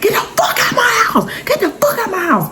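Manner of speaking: re-enacted very high-pitched, repeated furious screams; frantic screaming. Mother fights man off her son, frantic high screaming. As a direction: shriek each order high, over and over.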